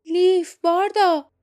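high, loud call across the square; shouting names. Acted. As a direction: yell to them, urgent. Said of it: "جاسمین فریاد زد".

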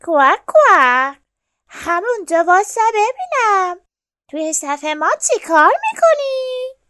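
loud, high, indignant duck voice; scolding storybook duck, rising high; angry ducks. A narrator's reading. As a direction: quack crossly and challenge the intruder. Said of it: "با عصبانیت به خرسه نگاه کردن و گفتن".

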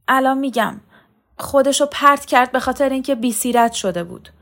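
high, loud, agitated woman; frightened confession. A narrator's reading. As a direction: blurt it out under threat. Choked woman confesses in panic. (اعتراف هراسان با صدای بلند و زیر)

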